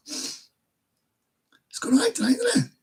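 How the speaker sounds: whiny, whispered fake crying, very high at the end; hammed-up whimpering; mock sob. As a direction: pretend to weep over it. After saying he has a broken heart.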